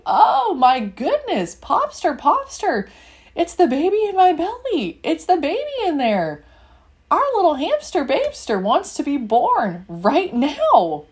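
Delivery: put-on the baby's coming, call out to dad; excited alarm. Mother telling Popster the baby is coming, excited and loud.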